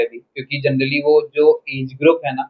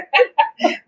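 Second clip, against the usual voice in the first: real laugh; 13 semitones higher than usual. Real, not acted. Laughing at his own joke.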